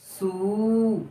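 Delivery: sū stretched long and held; सू with the long ऊ held and sung; drawn-out long oo. Shown as a demonstration. Teacher drawing out सू for children. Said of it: "वहां थोड़ा गाना गा के पढ़िए".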